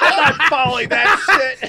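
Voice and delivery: laughing protest. Real, not acted. A friend laughing off the request to follow the story. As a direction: laugh while refusing to follow. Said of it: "Go ahead, phony soprano".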